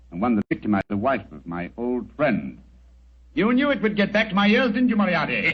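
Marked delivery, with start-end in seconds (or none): 3.3-5.5 triumphant accusation. Acted. Holmes unmasks the villain.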